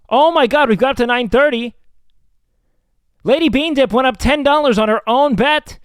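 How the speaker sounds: high loud excited shouting; thrilled the bid went up. Real, not acted. Excited bid jump in auction.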